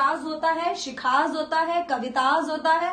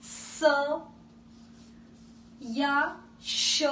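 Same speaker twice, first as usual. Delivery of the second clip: "स" then a long drawn-out "श", both hissed with no voice; hissing s and sh; 4 dB quieter, much slower than usual. Shown as a demonstration. Teacher hissing the sounds to show the name endings. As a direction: hiss "स", then stretch out "श". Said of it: "एंड में आपको".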